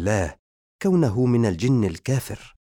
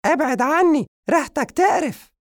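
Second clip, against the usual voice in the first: 1 shrill cat voice; 6 dB louder than usual. Acted. Cat pushes zombie away.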